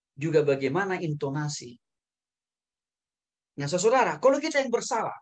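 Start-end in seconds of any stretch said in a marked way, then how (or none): none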